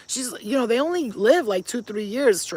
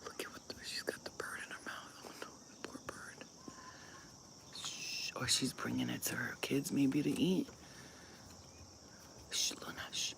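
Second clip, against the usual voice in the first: whispering so the cat isn't startled; very quiet, secretive whisper; hushed whisper; 7 semitones lower, 17 dB quieter than usual. Real, not acted. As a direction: whisper it, watching an animal up close. Hushed while watching the cat.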